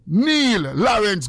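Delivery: ordinary delivery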